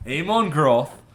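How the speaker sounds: name said in a German accent. Shown as a demonstration. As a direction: Amon Groth with a German accent. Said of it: "you have to give it in German accent".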